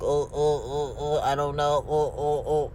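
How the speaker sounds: nervous stammering, halting oh oh; lightly voiced dazed mumbling; stammering husband.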